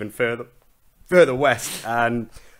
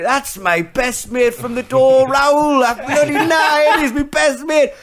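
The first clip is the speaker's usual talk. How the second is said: much higher, louder, slow sing-song mock; comic drunk Gascoigne, slow and sing-song, higher and louder; 7 dB louder, much slower than usual.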